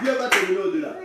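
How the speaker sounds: normal talking voice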